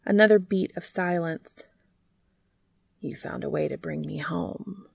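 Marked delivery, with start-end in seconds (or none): 3.0-4.9 softly, almost under the breath; quiet. A narrator's reading. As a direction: say it low and tender. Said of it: "the bee said quietly".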